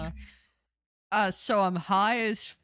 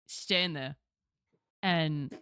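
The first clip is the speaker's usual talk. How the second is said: ordinary talk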